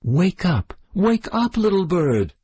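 urgent wake-up call. Acted. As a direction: call the bird back, rising in alarm. Trying to wake fainted bird.

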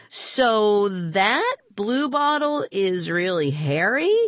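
high, slow wide-eyed question; astonished. Acted. Rima learns the bluebottle is Harry, astonished. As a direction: draw it out in dawning wonder.

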